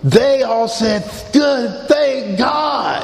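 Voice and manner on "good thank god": high, drawn-out mock relief; emphatic relief. Bartenders hearing he quit drinking, emphatic relief. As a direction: exclaim it high, hugely relieved. Bar staff relieved he's sober.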